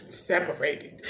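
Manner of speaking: normal talking voice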